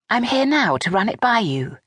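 a hint of a nervous daughter-in-law; Vanessa voice. A narrator's reading.

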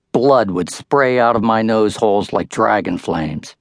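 ordinary delivery

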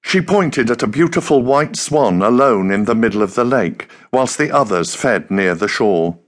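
usual speaking voice

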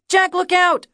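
put-on high, loud kid's scream of warning; boy shouting a sudden warning; yelled warning. A narrator's reading. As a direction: yell it out like a scared little boy. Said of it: "Kiki is yelling".

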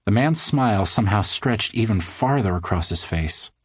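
ordinary delivery, nothing marked

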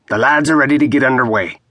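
brisk sailor reporting, quick; eager deckhand voice; rough first mate. A narrator's reading.

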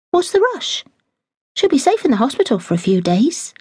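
a hint of a curious young girl; young maid pressing the housekeeper for news; eager young maid. Daisy voiced in reading.

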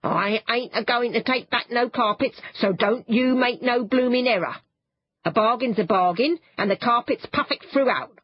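lightly voiced shopkeeper refusing a return; lightly voiced cockney shopman. A narrator's reading. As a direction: play the stubborn shopman, lightly defensive.

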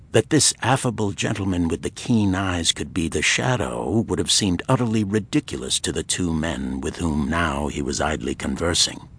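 ordinary talk, nothing marked

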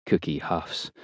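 usual speaking voice

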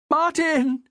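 high, falling cry of the name; high-pitched shocked call; horrified cry. A narrator's reading. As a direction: cry out as your husband is lost. Wife sees husband killed, horror.

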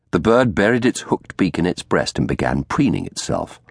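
ordinary delivery, nothing marked

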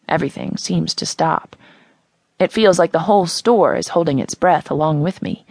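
usual speaking voice

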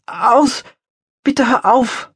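höher, verzweifelt bittend; flehend. A narrator's reading. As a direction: angstvolles Flehen an Hund und Mann. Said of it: "flehte sie gleichzeitig die Hündin und den Mann an". (Magdalena fleht Hündin und Täter an, verzweifelt höher)

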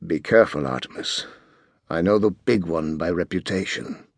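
low, hushed warning in the ear; whispered warning. A narrator's reading. Bodyguard whispers a warning into his charge's ear. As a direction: lean in and breathe the warning. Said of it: "Butler bent low to whisper".

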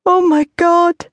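high, loud cry of anguish. A narrator's reading. Mother hearing bad news, high anguished cry. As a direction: gasp it in terror. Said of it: "cried the woman in the greatest anguish".

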